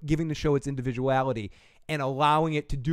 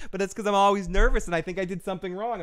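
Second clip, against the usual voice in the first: ordinary delivery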